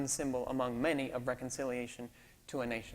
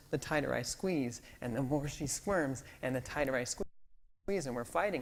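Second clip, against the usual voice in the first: normal talking voice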